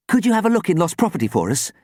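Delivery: high, quick plea; pleading. A narrator's reading. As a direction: beg a favour hopefully. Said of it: "Sam pleaded".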